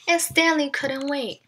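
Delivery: ordinary delivery, nothing marked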